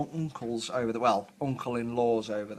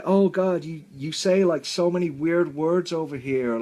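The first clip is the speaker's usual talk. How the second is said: higher, mock American accent; American girl; 5 semitones higher, 5 dB louder than usual. American workmate baffled by British words, imitated American accent. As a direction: put on a complaining American girl. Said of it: "I've got an American girl".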